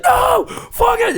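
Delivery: high, drawn-out protest yell; crowd screaming. Retold or imitated. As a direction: imitate an outraged crowd screaming. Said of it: "And the screaming begins".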